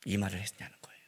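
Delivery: very soft, low whisper; spent, whispered conclusion; hushed aftermath. Real, not acted. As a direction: drop suddenly to a near-whisper. Sudden hush after the outburst.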